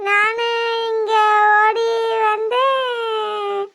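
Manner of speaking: slow, drawn-out sobbing dirge; keen for a lost grandchild, stretching each word; tearful singsong mourning; wailing lament. Acted. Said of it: "ஒப்பாரி வச்சுக்கிட்டு இருக்க".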